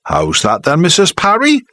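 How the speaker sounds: mock-polite jibe rising on Parry; playing to the crowd. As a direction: show off to the audience. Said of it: "still playing to the audience".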